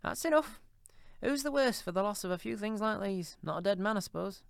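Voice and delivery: put-on high, coarse old charwoman, brazen; a bold thieving charwoman, defiant. A narrator's reading. Charwoman goes on.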